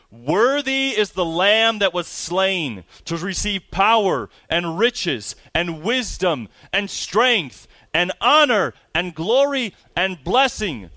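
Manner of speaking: slow, high, loud proclamation, phrase by phrase. A narrator's reading. Preacher quoting the angels praising the Lamb, loud voice. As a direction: ring out each item of praise, loud and measured. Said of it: "Saying with a loud voice".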